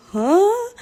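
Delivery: high, loud puzzled huh; confused huh. Retold or imitated. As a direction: sound totally lost. Retold own confusion.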